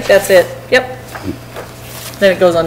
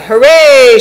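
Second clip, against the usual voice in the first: mildly raised, higher-pitched cheer; triumphant shout; 5 semitones higher, 4 dB louder than usual. A narrator's reading. King perched up high celebrates, mild narrated shout. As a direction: cheer for yourself in storybook fashion. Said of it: "shouted Yertle".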